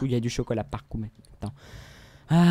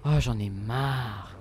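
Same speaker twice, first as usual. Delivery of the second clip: whiny fed-up moan; 3 semitones lower, slower than usual. Pretend. Game frustration, whining.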